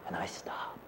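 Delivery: whispering. Acted. Freezing in fear.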